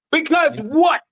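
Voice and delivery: high, pointed demand; challenging the clerk, high and pointed; mock challenge. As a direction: push back with a sharp high question. Retold confrontation with clerk.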